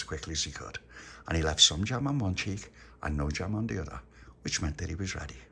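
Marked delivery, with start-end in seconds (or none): none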